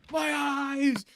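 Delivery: high, drawn-out mock wail; imitated scream of pain. Mimicking the scalded girlfriend again, high mock cry of pain. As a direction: wail it in hammed-up agony. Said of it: "Just the screaming".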